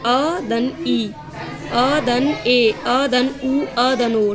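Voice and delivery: very slow, separate vowel sounds; slow vowel demo. Shown as a demonstration. Teacher slowly sounding vowel pairs for students. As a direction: spell each vowel out slowly. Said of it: "दो असमान स्वर के संयुक्त मिलने से".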